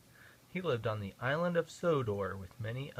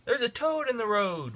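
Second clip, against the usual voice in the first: louder, higher call to passengers; 9 semitones higher, 4 dB louder than usual. A narrator's reading. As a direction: call out the news of the toad. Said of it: "His driver called out".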